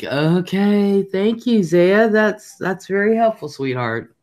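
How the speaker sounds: high, sugary mock gratitude; sarcastic thanks to a know-it-all grandson. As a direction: thank him with fake sweetness. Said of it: "I am like".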